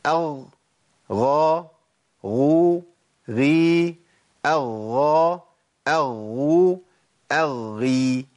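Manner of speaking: "أغ، غا، غو، غي" chanted one by one, then doubled forms; ghayn syllable drill. Shown as a demonstration. Teacher running through the seven cases, ghayn syllable drill. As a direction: recite each syllable separately and firmly. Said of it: "هقولهم تاني بشكل سريع".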